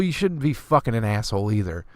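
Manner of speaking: usual speaking voice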